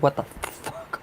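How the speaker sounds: hushed, voiceless exclamation; whispered curse. Acted. Acting out a startled client hearing spirits, whispered.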